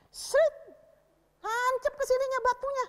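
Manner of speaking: excited climax. A narrator's reading. Teacher telling how the stone hits, excited. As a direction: shoot the words out like the slung stone. Narrating the stone striking Goliath.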